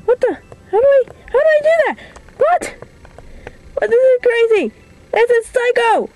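very high, fast startled cries; can't believe what just happened; shocked disbelief. Real, not acted. Sudden game surprise.

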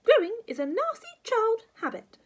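very high, loud, disgusted granny; shrieky old nag; scolding grandma. Acted. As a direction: pronounce it with loud disapproval.

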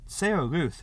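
plain reading voice

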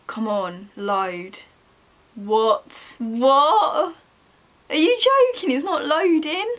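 annoyed shout at the screen; exasperated. Real, not acted. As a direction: cry out in frustration. Frustrated at page.

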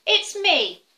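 high goat reply; brisk goat answer. Acted. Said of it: "Middle-sized Billy Goat Gruff".

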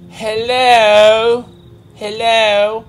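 high, loud, drawn-out English hello; mock English accent. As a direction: call out in a badly faked English accent. Calling out in put-on voice again.

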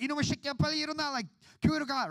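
high, rapid-fire scolding mom voice; scolding mother. Retold or imitated. Mom pulling her son by the ear toward church, high mock scolding. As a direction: imitate an exasperated mom in a high voice.

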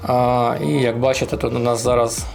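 ordinary delivery

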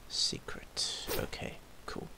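hushed whisper. Real, not acted. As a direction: drop to a whisper. Quiet aside while checking.

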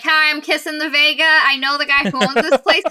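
a hint of a brash introduction; cocky bluff. Kissen bluffs into the inn.

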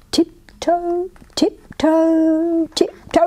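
high, light creeping chant; stealthy tiptoeing. A narrator's reading. Little tiger sneaking up to scare, stealthy. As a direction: place each word daintily, as if sneaking. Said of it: "as silently as he could".

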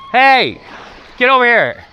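calling the dog back sharply. Real, not acted. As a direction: call her back across the beach.

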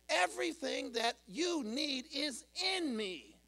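emphatic, high-pitched sermon climax; forceful preaching. Acted. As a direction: declare it as God with rising fervour. Preaches as God, rising force.

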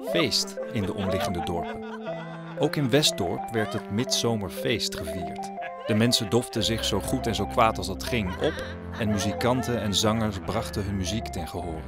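plain reading voice

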